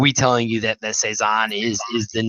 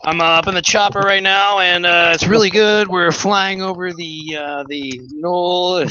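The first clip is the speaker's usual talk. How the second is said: mock airborne reporter in a chopper, raised pitch, words stretched out; helicopter impression; 6 semitones higher than usual. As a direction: imitate talking over helicopter rotors, pitched up and slowing at the end. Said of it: "That was my helicopter impression".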